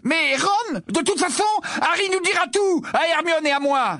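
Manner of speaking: high, heated outburst; flaring up. A narrator's reading. Ron bursts out that Harry will tell them, heated. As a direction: flare up at being left out. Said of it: "s'emporta Ron".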